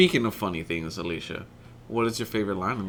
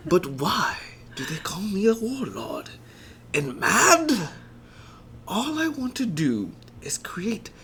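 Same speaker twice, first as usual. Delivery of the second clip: building from whispered to high, crying shout; hammed-up tragic megalomaniac; Bison villain speech; 9 semitones higher than usual. Performing Bison's monologue, whisper building to anguished cry.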